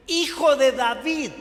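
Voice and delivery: grito fuerte y alto. Retold or imitated. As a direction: clama con fuerza. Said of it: "Por eso él grita y dice". (el ciego grita a Jesús)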